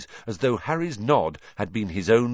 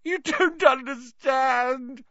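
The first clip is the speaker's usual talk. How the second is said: high, slow, drawn-out whine; tearful high-pitched protest; whining; slower than usual. A narrator's reading. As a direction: whine it pitifully. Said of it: "whined Pettigrew".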